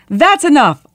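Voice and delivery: high, loud outburst; shouting. A narrator's reading. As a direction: cut them off with an exasperated shout. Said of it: "Akbar shouted".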